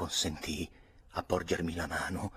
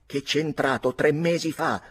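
higher, louder sneering jab; sneering retort; 8 semitones higher, 6 dB louder than usual. A narrator's reading. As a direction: sneer the line back at her. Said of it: "ribatté Lord George sogghignando". (ribattuta beffarda, con un ghigno)

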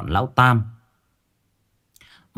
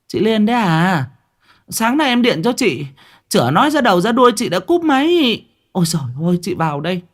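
flustered call; 7 semitones higher than usual. Acted. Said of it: "hồ hỏi".

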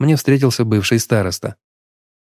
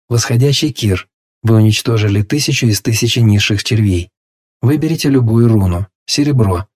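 louder, measured system notification read; system announcement; 3 dB louder than usual. Acted. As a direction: read it like a game interface. Reads system message again.

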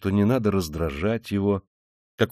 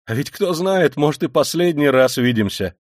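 raised, louder afterthought; inadvertent remark; 6 semitones higher, 5 dB louder than usual. A narrator's reading. Rodya to his family at parting, blurting it unintentionally. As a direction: let it slip out, pitched up. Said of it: "прибавил он нечаянно".

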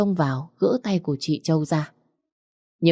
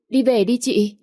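pushier urging; 7 semitones higher than usual. Acted. Stepsister presses her sister to leave, insistent raised plea. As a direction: push her harder to leave now.

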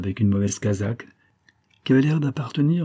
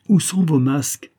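loud, higher-pitched angry question; violent demand; 7 semitones higher, 5 dB louder than usual. A narrator's reading. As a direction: demand it forcefully, voice raised. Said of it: "répéta-t-il avec sa violence ordinaire".

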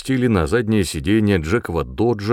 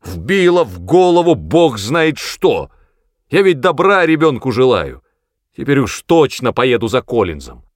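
loud, indignant grumbling, higher at first; angry outburst; 4 semitones higher, 6 dB louder than usual. A narrator's reading. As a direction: burst out loudly in exasperation. Angry father's outburst about the boy. (сердито, громко возмущаясь)

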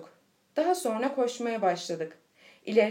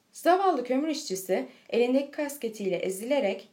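ordinary delivery

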